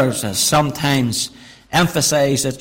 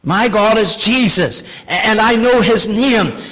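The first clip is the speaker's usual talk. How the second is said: louder, rising proclamation; fervent declaration; 6 semitones higher, 5 dB louder than usual. Acted. Preacher proclaiming his God to the congregation, fervent. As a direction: declare your faith boldly.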